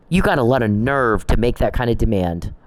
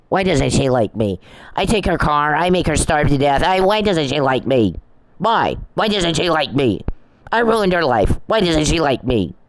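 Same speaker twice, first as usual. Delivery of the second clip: higher-pitched mock lament; mocking whine; 4 semitones higher than usual. Mocking the man who took her car, mock-whining. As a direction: mimic a self-pitying rejected suitor, pitched up. Mimics rejected man's escalating complaint.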